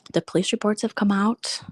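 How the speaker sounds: ordinary delivery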